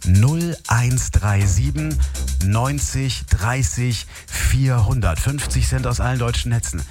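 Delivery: slow, low, drawn-out phone number; hotline number readout. Acted. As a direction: read out the call-in number slowly. Host reads hotline number.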